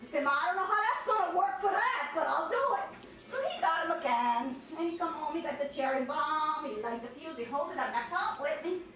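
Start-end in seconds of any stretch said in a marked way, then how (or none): none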